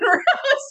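high burst of embarrassed laughter; a squeal of a laugh at her own mess. Real, not acted. Embarrassed over crumpled autograph.

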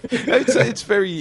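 words breaking into a laugh. Real, not acted. Host laughs at guest's apology.

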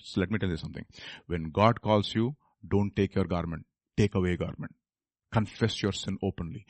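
very soft, low, confiding counsel; hushed preaching. Acted. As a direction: lean in quietly with grave advice.

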